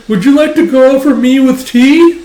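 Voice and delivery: high, loud nervous invitation; hammed-up awkward eagerness; flustered suitor. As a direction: blurt it out like a smitten kid. Flustered suitor asks woman out.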